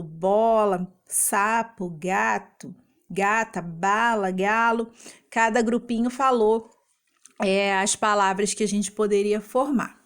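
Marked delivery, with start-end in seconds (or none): none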